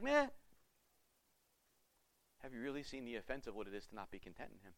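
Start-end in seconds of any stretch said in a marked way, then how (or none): 0.0-0.3 a bored, unimpressed shrug of a sound; indifferent meh. Pretend. Listener shrugging off the gospel, indifferent. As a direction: shrug it off like it means nothing. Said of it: "you're kinda like".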